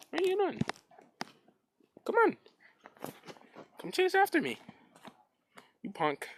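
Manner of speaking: very high, sing-song call; coaxing the dog to chase him, high voice; playful dog talk. Real, not acted. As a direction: tease the dog into chasing.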